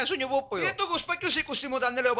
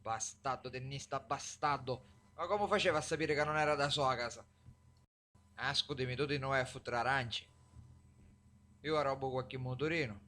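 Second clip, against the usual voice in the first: quiet, low grumbling; low bitter muttering; 13 semitones lower, 8 dB quieter than usual. Acted. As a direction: grumble after the beating.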